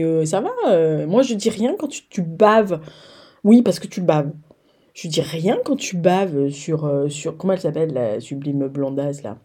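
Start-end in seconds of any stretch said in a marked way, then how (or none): none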